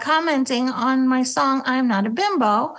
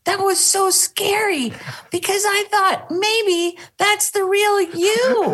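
higher, mock worried father; lightly voiced frightened dad; imitating dad; 8 semitones higher than usual. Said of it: "my dad called me and he goes".

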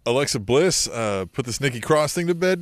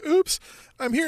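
very high, coy oops; high innocent little voice; mock oops; 12 semitones higher than usual. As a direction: play dumb sweetly.

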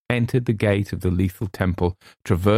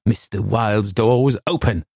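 usual speaking voice

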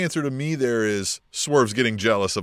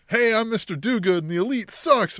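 high-pitched goody-goody send-up; lightly mock a cheery good guy, pitched up; mock do-gooder; 8 semitones higher than usual. Mocking a wrestler's good-guy persona.

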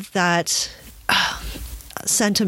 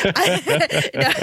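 laughing; 8 semitones higher than usual. Real, not acted. Laughing at own joke.

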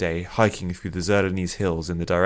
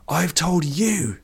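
slow, high-pitched strained shout; shouting back; 10 semitones higher, 3 dB louder, much slower than usual. A narrator's reading. Addict shouts back at the old man, shouting. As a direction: throw it back high and drawn out. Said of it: "Vish shouted back".

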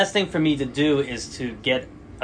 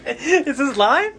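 laughing question; 9 semitones higher, 3 dB louder, much slower than usual. Real, not acted.

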